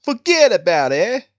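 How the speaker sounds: badly faked New York wiseguy accent. Shown as a demonstration. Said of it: "my New York and New Jersey".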